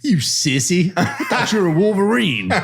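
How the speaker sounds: high-pitched mocking jab; mock taunt. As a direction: needle him playfully. Teasing the praying Wolverine.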